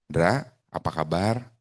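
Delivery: low, slow, hesitant-sounding how-are-you; about to borrow money. Shown as a demonstration. Coach mimicking a caller who wants a loan, sheepish borrower tone. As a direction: drag it out like a favour is coming. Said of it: "Ini kayak nada mau pinjam duit nih".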